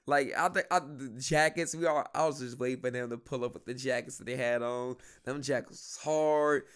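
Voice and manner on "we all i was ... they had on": usual speaking voice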